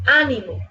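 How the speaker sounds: ánimo with the stress on Á; stress on first syllable. Shown as a demonstration. Teacher showing where the stress falls, first syllable. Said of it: "por la posición del acento podemos distinguir".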